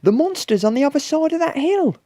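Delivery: fast, high, loud squeak; shrieky little-character voice at speed. A narrator's reading. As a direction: squeak the news urgently. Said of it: "squeaked Basil suddenly".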